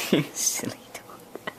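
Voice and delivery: soft, slow whisper to the dog. Real, not acted. Whispering fondly to her silly dog. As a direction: murmur it tenderly, slowly.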